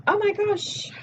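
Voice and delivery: high, sudden yelp of surprise; real startled cry. Real, not acted. Vlogger spots a gnat, a sudden high startled oh my gosh. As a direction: jump at the bug.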